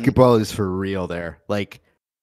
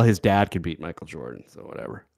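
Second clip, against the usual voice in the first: normal talking voice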